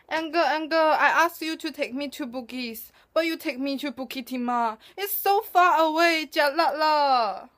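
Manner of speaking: high whiny Singlish, breaking to a fake cry; complaining Singlish, crying at the end. Shown as a demonstration. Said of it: "'cause I can speak Singlish".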